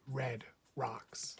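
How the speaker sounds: usual speaking voice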